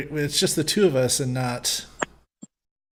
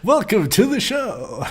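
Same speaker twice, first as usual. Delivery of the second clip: slow, higher announcer voice, laughing; radio voice; 7 semitones higher, 3 dB louder, slower than usual. Pretend. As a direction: play a cheesy radio host. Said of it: "my radio voice".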